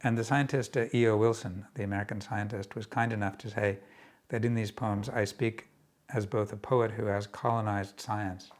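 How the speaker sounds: usual speaking voice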